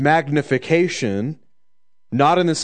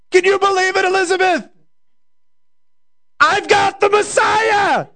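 mock scream; 4 dB louder than usual. Mary shrieking her news to Elizabeth, a mock scream. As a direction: scream it out in wild glee. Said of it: "Why has he gotta scream in a sermon?".

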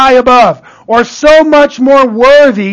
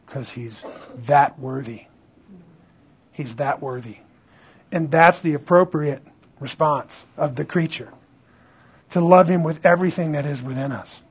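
much lower, softer preaching voice; hushed reverence; 9 semitones lower, 12 dB quieter than usual. Real, not acted. Preacher telling listeners God is that worthy, hushed and reverent. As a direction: drop low and soft.

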